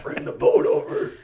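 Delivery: high, breathless strained croak; winded. Retold or imitated. Calling for the boat after hitting the water, winded. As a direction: gasp it out with no wind left. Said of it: "Had the wind knocked out of me".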